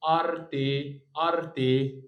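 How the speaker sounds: أرتي said slowly and clearly; Italian word demo. Shown as a demonstration. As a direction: model أرتي for students. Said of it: "يبقى كلمة اسمها إيه؟". (نطق أرتي بوضوح)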